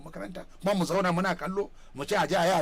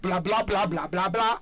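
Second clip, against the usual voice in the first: imitating empty blah-blah babble; mock babbling; slower than usual. Asking whether the president was seen talking out of direction, mock babbling. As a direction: babble a run of blahs in mimicry.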